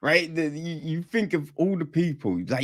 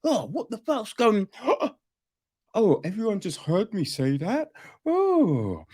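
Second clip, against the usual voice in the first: high-pitched huffy expert with gasps; mock indignant; 8 semitones higher than usual. Expert dragged in, starting to protest, mock indignant. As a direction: act out hammed-up indignation, oohing and gasping. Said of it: "starting to get indignant".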